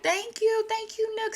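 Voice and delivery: high squeaky bird voice; grateful birds. Retold or imitated. Birds thank the Nook, high piping gratitude. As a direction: pipe up thanks in a tiny bird voice. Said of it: "they're thanking him".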